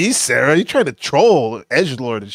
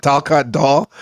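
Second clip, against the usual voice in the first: usual speaking voice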